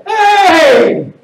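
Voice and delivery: high, excited shout; hammed-up excited Italian cry. Shown as a demonstration. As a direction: holler it out in delight. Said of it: "then you're excited".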